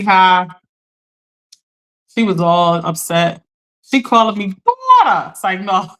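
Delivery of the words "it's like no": normal talking voice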